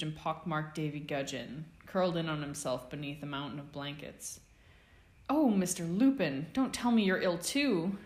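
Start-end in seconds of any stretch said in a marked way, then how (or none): 5.3-8.0 louder, higher harried exclamation; exasperated declaration. A narrator's reading. Matron dismayed seeing the student in her ward, loudly. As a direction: burst out in weary dismay. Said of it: "she declared".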